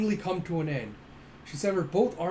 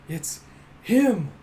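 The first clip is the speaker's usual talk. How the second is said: higher, louder shocked outburst; shocked cry; 5 semitones higher, 9 dB louder than usual. Retold or imitated. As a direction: Zeka recognizing him, a shocked cry. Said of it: "Zeka just completely shocked".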